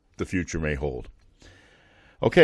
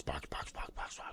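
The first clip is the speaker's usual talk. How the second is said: whispered echo dying away; fading echo; 5 semitones lower, 21 dB quieter than usual. Shown as a demonstration. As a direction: let the word echo out softly. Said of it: "you can actually hear box".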